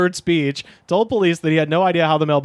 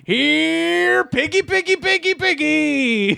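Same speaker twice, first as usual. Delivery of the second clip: high, drawn-out hog call; singsong high-pitched mock taunt; imitated taunting scream; 12 semitones higher, much slower than usual. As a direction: call the pigs in a high, long mock yell. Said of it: "throwing things into the street and screaming".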